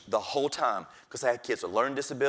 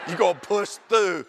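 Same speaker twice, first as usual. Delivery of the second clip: firm self pep talk; 7 semitones higher, 6 dB louder than usual. Retold or imitated. Pep talk to himself in car.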